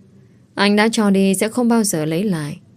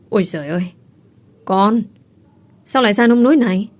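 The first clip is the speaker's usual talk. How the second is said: tiếng kêu thảng thốt, cao dần, nhanh ở câu cuối; người mẹ kêu lên khi thấy con gái nhếch nhác; hoảng hốt; 3 semitones higher, faster than usual. Acted. (mẹ thảng thốt kêu con)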